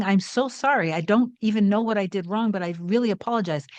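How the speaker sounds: ordinary talk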